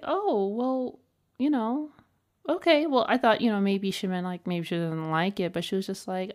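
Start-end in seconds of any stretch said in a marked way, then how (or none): 0.0-2.9 awkward high reply. Retold or imitated. Her awkward reply to the cashier.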